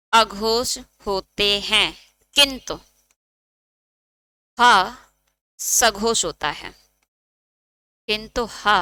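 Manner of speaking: measured note-dictation voice, phrase by phrase; slow dictation. Acted. Teacher dictating a note for students to copy, slow dictation. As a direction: stretch each term out, pausing between phrases.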